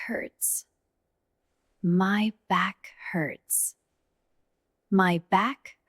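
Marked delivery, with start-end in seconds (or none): none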